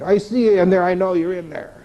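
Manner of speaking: a lightly voiced landlord catching him hiding.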